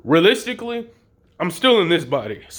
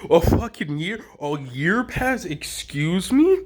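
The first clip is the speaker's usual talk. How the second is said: incredulous echo, drawn out toward the end; acted disbelief; 3 semitones higher, slower than usual. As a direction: repeat the news slowly as a stunned question. Echoes the news in disbelief.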